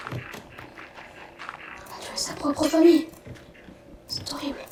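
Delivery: slow, hushed horrified whisper. Real, not acted. Gamer appalled at the cow killing its family, horrified whisper. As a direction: breathe it out, aghast.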